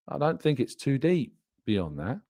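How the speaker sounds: normal talking voice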